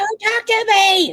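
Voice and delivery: high, loud, drawn-out mock scream; hammed-up screaming tantrum. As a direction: scream it slowly, imitating an online meltdown. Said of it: "you come online screaming and crying".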